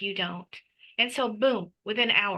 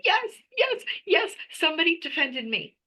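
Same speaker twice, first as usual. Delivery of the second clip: a much higher, giddy voice, as if rejoicing aloud; thrilled cheering; 8 semitones higher than usual. Retold or imitated. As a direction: squeal with joy at being defended. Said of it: "I'm like".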